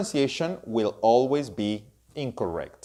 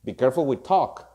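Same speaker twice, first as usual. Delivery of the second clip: ordinary talk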